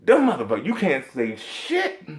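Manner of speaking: ordinary delivery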